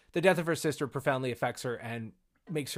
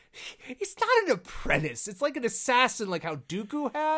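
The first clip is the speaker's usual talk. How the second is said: squirm out of it in a high comic voice; Maul making excuses; 8 semitones higher than usual. Acted. Maul explaining away his apprentice, high mock protest.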